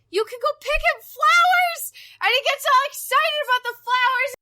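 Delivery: high, loud, squealing gush; excited squealing. Real, not acted. Gamer gushing to friends about the prince, high squeals. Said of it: "the description just became squealing".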